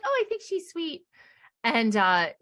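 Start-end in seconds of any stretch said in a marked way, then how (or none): 0.0-1.0 sweet mock voice. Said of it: "she's like".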